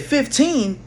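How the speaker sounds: high incredulous Fifteen?; retelling his shock on hearing the age. Retold or imitated. To the cop, shocked disbelief at her age.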